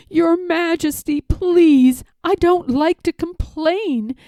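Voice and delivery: high, strained pleading, rising to a cry; a crushed turtle groaning up to the king; groaning plea. A narrator's reading. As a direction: beg the king from under the stack. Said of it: "groaned Mac".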